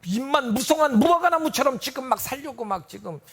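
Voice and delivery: loud, sing-song sermon cry; preaching at full force. Acted. Preacher pleading with the congregation, loud sing-song sermon. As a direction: pour it out over the congregation.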